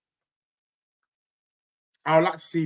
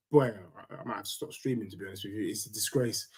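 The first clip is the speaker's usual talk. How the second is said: much softer, lower dejected trail-off; softer, lower resigned mutter about quitting streaming; mock despair; 10 semitones lower, 11 dB quieter, faster than usual. Real, not acted. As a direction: sink into quiet gloom at the thought of losing. Fearing a loss to West Ham.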